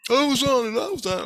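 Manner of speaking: very high, mocking falsetto; squeaky put-on voice, falling away at the end; mimicked protest. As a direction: mimic someone frantically protesting. Others' protest mimicked between own replies.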